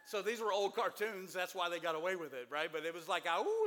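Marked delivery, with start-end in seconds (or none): 3.3-3.6 high, loud cartoon howl; a quick howl at the moon; wolf howl. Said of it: "it's like Howling at the Moon".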